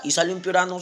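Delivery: ordinary talk, nothing marked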